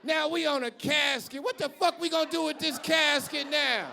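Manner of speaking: loud ranting about the wasted casket; mock outraged shout. Family shouting over the bought casket, mock rage. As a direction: yell it like the family's furious.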